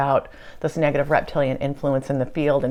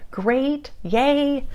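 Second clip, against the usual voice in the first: excited high yay; 10 semitones higher than usual. Retold or imitated. Excited reply to channeled queen.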